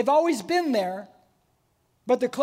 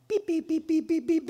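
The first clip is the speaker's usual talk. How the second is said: imitating a monitor beep; 4 semitones higher than usual. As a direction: mimic the fetal monitor beeping. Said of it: "and then go".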